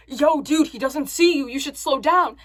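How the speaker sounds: loud, alarmed retold warning; urgent warning. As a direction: call out to the driver to stop.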